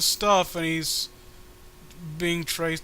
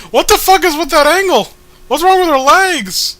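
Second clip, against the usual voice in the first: much higher and louder, yelling in disbelief; shocked yell; 11 semitones higher, 13 dB louder than usual. Real, not acted. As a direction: shout at the absurd picture. Shocked at the drawing's angle.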